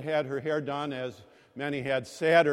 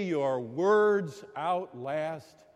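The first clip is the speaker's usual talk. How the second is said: drawn out, each word weighed; slow emphatic maxim; much slower than usual. Real, not acted. Preacher telling the congregation a lesson, slow and deliberate. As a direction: lay it down slowly like a proverb. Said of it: "Believe me".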